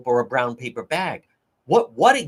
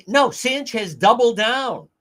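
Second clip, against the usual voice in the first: ordinary delivery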